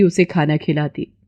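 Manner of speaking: usual speaking voice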